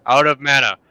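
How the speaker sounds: loud, strained out of mana; exaggerated gasp. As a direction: belt it like a drained caster.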